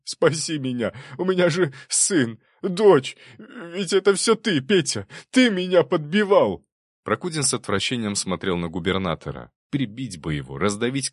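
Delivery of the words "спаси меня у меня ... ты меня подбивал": high-pitched, whining desperation turning to accusation; beg, then blame him in a high loud wail; panicky, near-hysterical babble; frantic pleading. A narrator's reading. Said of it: "бормотал он".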